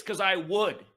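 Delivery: normal talking voice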